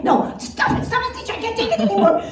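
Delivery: put-on small, high, softened childish plea; pleading little kid. Little Bobby can't take it anymore, soft high whimpering plea. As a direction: plead weakly in mock distress.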